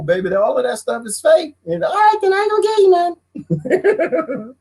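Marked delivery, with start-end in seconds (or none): 1.8-4.6 very high, fast sassy voice; hammed-up high wife voice; mock wife threat. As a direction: tease him with a threat. Wife's retort.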